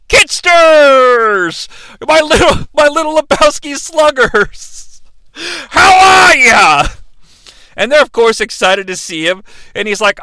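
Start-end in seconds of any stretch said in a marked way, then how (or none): 0.1-6.9 high, loud drawn-out holler; hammed-up hearty greeting. Lebowski greets the kids, loud hearty holler. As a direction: bellow it like a jolly uncle.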